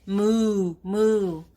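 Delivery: loud lowing moo; calf moo. Acted. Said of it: "checking out this calf".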